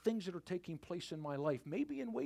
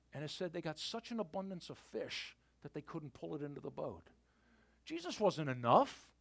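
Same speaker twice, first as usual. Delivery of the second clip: usual speaking voice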